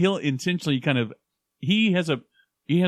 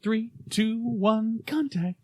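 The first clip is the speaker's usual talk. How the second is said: TV intro imitation; 9 semitones higher, much slower than usual. Recalling a TV show jingle.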